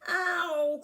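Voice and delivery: high, loud yelp of pain; a sharp hurt yelp; crocodile's cry of pain. A narrator's reading. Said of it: "cried the crocodile".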